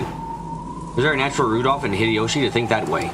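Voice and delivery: steady, even reading of the story; story narration. Acted. Streamer reading the novel's narration aloud, even narration. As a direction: narrate the mystery calmly and evenly.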